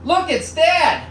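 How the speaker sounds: put-on high child's excited shout; daughter yelling. Comedian imitating his daughter calling from the living room. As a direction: squeal it like an excited little girl. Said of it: "I hear her yell out".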